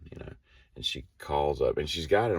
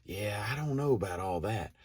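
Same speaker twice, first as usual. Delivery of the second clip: lightly voiced employee; 6 semitones higher, 7 dB quieter than usual. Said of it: "she's like".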